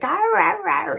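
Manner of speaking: dog barking. Shown as a demonstration. Imagining her own dog giving them away, barking. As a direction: bark like an excited little dog. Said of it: "do the dog barking sound".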